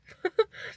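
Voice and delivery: high, loud laugh at herself; burst of laughter. Real, not acted. Laughing at how much her business means, loud laugh. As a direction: let a bright laugh burst out.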